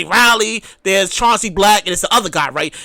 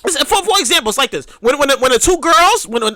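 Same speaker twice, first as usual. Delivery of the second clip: ordinary delivery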